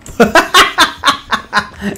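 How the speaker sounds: high, loud burst of real laughter. Real, not acted. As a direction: crack up out loud. Teammate rolled a nine. (carcajada fuerte y aguda)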